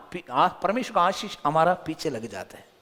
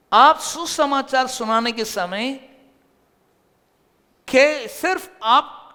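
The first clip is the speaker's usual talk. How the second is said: slow, louder and higher for emphasis; emphatic preaching; 8 semitones higher, 7 dB louder, slower than usual. Acted. Preacher stresses the key lesson to the congregation, slow and loud. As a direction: drive the point home slowly.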